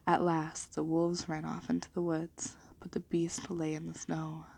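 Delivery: ordinary delivery, nothing marked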